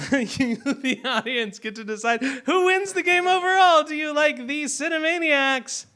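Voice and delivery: high, booming game show host; booming prize announcer. Acted. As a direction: pump up the crowd like an announcer. Host builds final vote.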